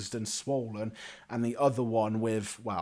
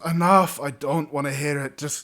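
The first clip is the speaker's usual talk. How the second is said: loud, raised "enough"; exasperated cut-off; 7 dB louder than usual. Acted. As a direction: cut him off forcefully. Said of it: "puts up his hand and goes".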